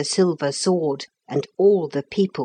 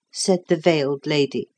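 ordinary delivery, nothing marked

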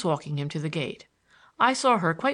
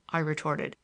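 plain reading voice

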